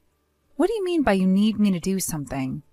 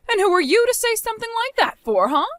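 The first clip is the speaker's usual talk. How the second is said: high outraged retort; 12 semitones higher, faster than usual. Acted. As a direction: lash out, appalled. Mother-in-law hears about husband's death.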